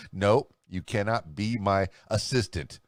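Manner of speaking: normal talking voice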